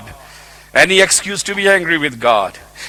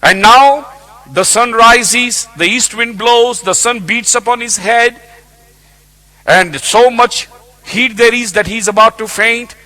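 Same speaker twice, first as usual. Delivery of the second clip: full-force preaching; 8 semitones higher, 8 dB louder than usual. Acted. Preacher painting Jonah's heat and despair, loud. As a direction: hammer the scene home from the pulpit.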